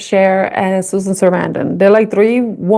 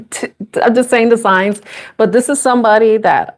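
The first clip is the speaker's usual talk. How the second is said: normal talking voice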